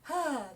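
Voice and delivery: breathy, falling sigh; airy "hah". Shown as a demonstration. Teacher showing singers what to avoid, airy exhale. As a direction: dump the air out in one sigh. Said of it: "just using our air and our breath to".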